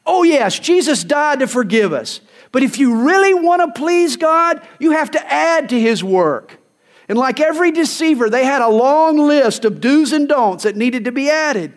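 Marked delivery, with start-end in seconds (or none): 0.1-6.6 higher, coaxing salesman's pitch, a bit louder; lightly mocking false teacher's spiel; false teachers' pitch. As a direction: sell the added rules sweetly. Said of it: "Here was their rap".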